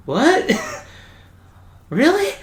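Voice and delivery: incredulous reaction. Retold or imitated. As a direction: act out a startled double take. Retold surprised reaction to news.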